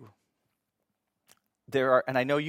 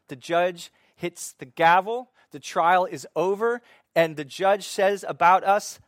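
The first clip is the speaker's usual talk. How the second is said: louder, higher, building intensity; rising preaching fervor; 4 semitones higher, 8 dB louder than usual. Acted. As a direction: preach it like the verdict is coming. Building courtroom image in sermon.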